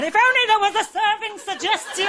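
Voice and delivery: high, lightly voiced baffled drinker; confused mock voice. Imaginary confused drinker wishing for help, lightly mocked. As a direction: sigh it out, lost. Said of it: "Stood there in a confused manner going".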